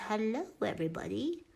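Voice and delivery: a hint of a goofy puppy voice, rising on hello; silly dog voice. Speaking for the puppy to the viewers, light silly dog voice. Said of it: "Why do I talk like an idiot when there's a dog?".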